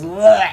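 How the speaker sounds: gurgling collapse bleat; dying goat bleat. Retold or imitated. Storyteller imitating the goat as it goes down, gurgled bleat. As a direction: rattle out a goat's last bleat. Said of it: "the goat goes down, he goes".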